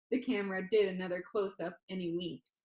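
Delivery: usual speaking voice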